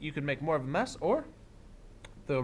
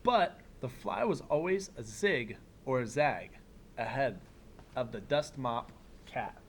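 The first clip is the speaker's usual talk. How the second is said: put-on reading to children; 4 dB quieter than usual. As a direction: read it like story time for a class. Reads picture book aloud.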